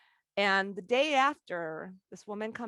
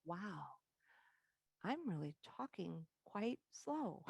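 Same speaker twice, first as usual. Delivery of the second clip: quiet amazed realization; 12 dB quieter, slower than usual. Retold or imitated. Surprised at her own podcast.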